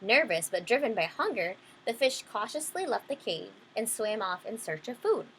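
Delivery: plain reading voice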